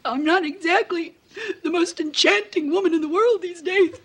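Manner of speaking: high, tearful self-pity; weepy, voice climbing high; crying. Acted. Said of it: "I am crying".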